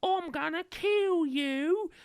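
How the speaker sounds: mock villain, high and drawn out; hammed-up villain. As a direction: ham up a pantomime baddie. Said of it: "the proper".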